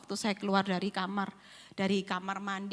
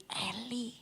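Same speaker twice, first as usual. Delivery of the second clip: whispered name; 9 dB quieter than usual. Retold or imitated. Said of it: "Tuhan cuman bilang gini".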